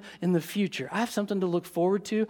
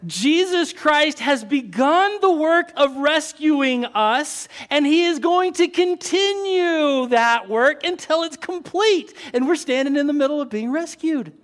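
rising preacher's build, higher and louder; preaching; 7 semitones higher, 4 dB louder than usual. Acted. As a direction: build it toward a climax.

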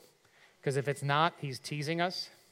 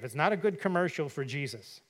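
usual speaking voice

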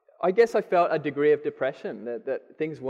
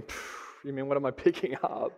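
laughing; 2 semitones lower, 8 dB quieter than usual. Retold or imitated. Joking about buying takeaway.

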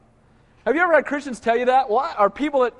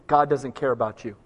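much lower, mocking voice of the enemy; 10 semitones lower than usual. Preacher voicing the enemy's lie to the church, low mocking. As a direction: drop low and sneer it like the tempter. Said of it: "And the enemy tells you".

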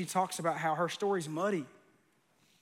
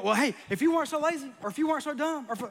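mock wife snapping back, higher and louder; imitating his wife; 9 semitones higher, 4 dB louder than usual. Teasing impression of his wife firing back in a row over dishes, higher and louder. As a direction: play Cynthia hitting back in a heated spat. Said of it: "that's her saying that".

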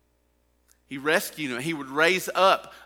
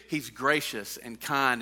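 normal talking voice

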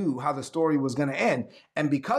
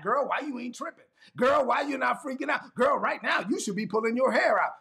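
put-on higher-pitched, scolding girlfriend act; mock alarm, voice raised in pitch; agitated friend; 7 semitones higher than usual. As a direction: imitate a friend freaking out on your behalf. Acts out friends freaking out.

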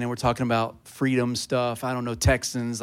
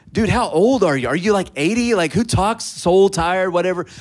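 mocking bystander; 5 semitones higher, 5 dB louder than usual. Crowd mocking the preacher.

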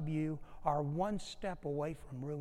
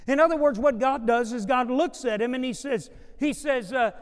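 loud, high-pitched preaching build; preaching at full force; 6 semitones higher, 9 dB louder than usual. Acted. Pastor preaching loudly to his congregation. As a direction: drive it home to the room.

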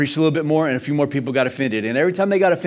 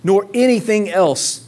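emphatic preaching; 7 semitones higher, 5 dB louder, slower than usual. Acted. Preacher building the list.

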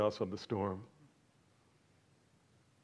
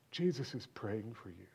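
normal talking voice

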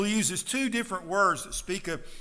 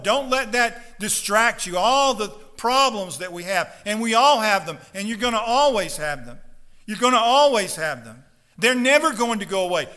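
loud, fiery preaching to the congregation; full-force preaching; 4 semitones higher, 7 dB louder than usual. Acted. Pastor urging his congregation not to whine, fiery loud preaching. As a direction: pound the message home from the pulpit.

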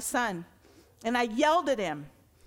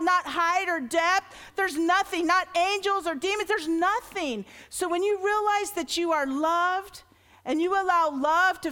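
raised, louder preaching build; preaching at full force; 5 semitones higher, 4 dB louder than usual. Acted. Pastor preaching passionately to the church, loud and lifted. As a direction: preach it out to the congregation.

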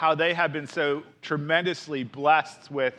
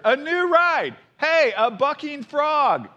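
put-on much higher, louder storybook child; excited kid shout; 10 semitones higher, 4 dB louder, slower than usual. A narrator's reading. Sister cub pointing out a frog ride, excited shout. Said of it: "shouted sister".

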